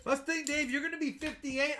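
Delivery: normal talking voice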